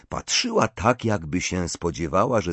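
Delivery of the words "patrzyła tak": plain reading voice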